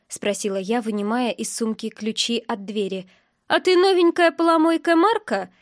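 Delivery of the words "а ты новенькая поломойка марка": put-on high, loud drunken woman's challenge; aggressive question. A narrator's reading. Drunk woman accosts the heroine at the gate, aggressively. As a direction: confront a stranger pushily. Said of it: "спросила она агрессивно".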